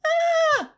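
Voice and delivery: very high, loud shriek; little girl's scream. A narrator's reading. Little sister screams down the hall, high shriek. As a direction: shriek like a small child. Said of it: "my sister scream down the hall".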